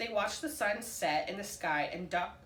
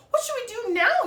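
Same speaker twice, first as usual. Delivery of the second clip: much higher and louder; hollering; 11 dB louder than usual. A narrator's reading. Duck hollering in panic as the egg moves. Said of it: "he hollered".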